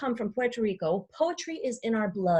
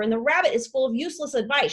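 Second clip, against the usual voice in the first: ordinary delivery, nothing marked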